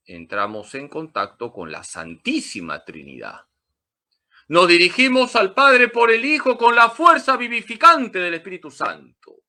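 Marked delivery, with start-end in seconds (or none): 4.5-9.4 loud, high proclamation from the pulpit; fervent preacher's cry; forceful preaching. Real, not acted. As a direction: ring it out to the whole church.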